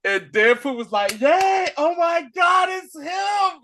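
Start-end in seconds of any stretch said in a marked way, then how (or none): 1.2-3.6 high excited squeal of delight; imitating Deadpool's giddy high shout. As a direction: squeal like a fan seeing his hero. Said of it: "And he was so excited".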